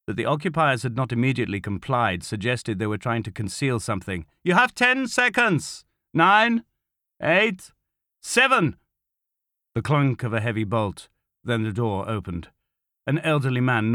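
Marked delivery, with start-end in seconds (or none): 4.4-8.8 high, loud, slow countdown; shouted countdown. Acted. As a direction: bark each number. Counting down at the door.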